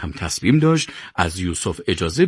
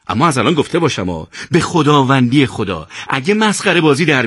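raised, insistent threatening scolding; stern warning; 7 semitones higher, 4 dB louder than usual. A narrator's reading. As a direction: lay down the law to troublesome boys. Yusuf gives in, warns the boys. (هشدار تند و تهدیدآمیز)

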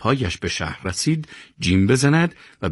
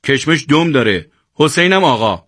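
raised, irritated correction; annoyed growled retort; 6 semitones higher, 5 dB louder than usual. A narrator's reading. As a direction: put the cheeky lad in his place. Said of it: "چشم قره رفت و قرید". (غرولند عصبانی و تشرآمیز)

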